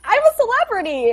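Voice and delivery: high, loud, proud boast; proud mom voice. Retold or imitated. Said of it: "and she's like".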